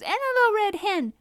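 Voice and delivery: high, loud cub squeal; excited little-fox scream. A narrator's reading. Other two little foxes joining in, screaming. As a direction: shriek it out eagerly. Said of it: "screamed the other two".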